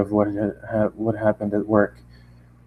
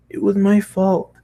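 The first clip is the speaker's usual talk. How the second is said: MY raised high and slowed, pleading; insisting; 10 semitones higher than usual. Acted. Pony insisting to Matt it was her fault, high pleading. Character insists blame is hers.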